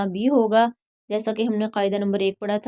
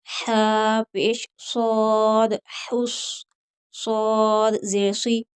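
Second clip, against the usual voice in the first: slow, drawn-out letter-by-letter recitation; slow tajweed drill; slower than usual. Shown as a demonstration. Teacher drilling students letter by letter, slow recitation. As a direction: spell and join each syllable like a Quran teacher.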